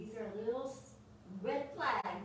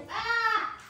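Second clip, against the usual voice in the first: sudden high loud ah; startled cry; 11 semitones higher, 12 dB louder than usual. Real, not acted. Startled yelp when the dog surprises her.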